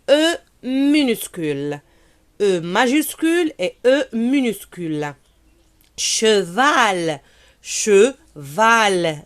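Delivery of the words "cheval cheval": "Cheval" said slowly and clearly, falling; teacher models "Cheval" syllable by syllable; model word. Shown as a demonstration. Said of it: "Répétez".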